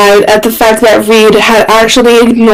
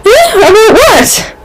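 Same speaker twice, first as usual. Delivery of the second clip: high, wide-eyed feigned innocence; high, mock-innocent question; innocent Reed; 11 semitones higher than usual. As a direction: play dumb with big eyes. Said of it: "made his eyes go big".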